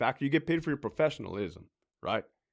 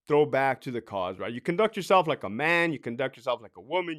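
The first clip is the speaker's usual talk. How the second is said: ordinary talk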